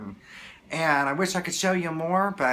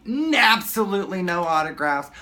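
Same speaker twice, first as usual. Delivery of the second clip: imitating a bodyguard's firm warning, higher and louder; lightly mimicked security guard; stern bodyguard; 5 semitones higher, 6 dB louder than usual. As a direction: lay down the rule, punching the first word. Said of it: "this gentleman kept walking back and forth saying".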